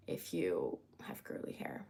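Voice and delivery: a low, breathy whisper with no voice; whispered aside. Shown as a demonstration. To her stream viewers, repeating the hair tip as a whisper. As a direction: drop it to a secret whisper.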